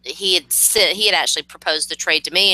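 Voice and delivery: ordinary talk, nothing marked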